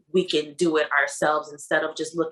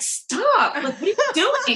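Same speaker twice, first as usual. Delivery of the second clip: high, squealed mock objection; laughing protest; 13 semitones higher, 4 dB louder than usual. Replaying how she stopped her boyfriend rubbing her stomach, laughing protest. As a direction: protest through a giggle, pitched way up. Retold protest at belly rub.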